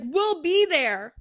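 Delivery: bright and loud, drawn out slowly; eager acceptance. Retold or imitated. Ash eagerly accepting the party invite, loud and high.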